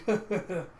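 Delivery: imitated bashful-trickster laughing; acted chuckle. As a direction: laugh along in character. Acts out character laughing along.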